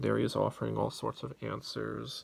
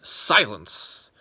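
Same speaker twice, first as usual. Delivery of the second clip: louder, sharp cry of silence; shouted command; 11 dB louder than usual. Acted. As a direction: silence the buzzing swarm.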